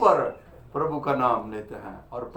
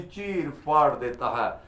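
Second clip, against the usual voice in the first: normal talking voice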